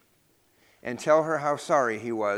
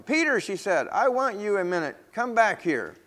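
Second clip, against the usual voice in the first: calling out; 7 semitones higher than usual. Acted. Shopkeeper calling Peter back down the street, mild call. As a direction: call him back from afar, higher. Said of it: "heard Missus Green calling him".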